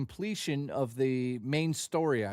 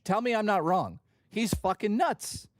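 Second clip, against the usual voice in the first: ordinary delivery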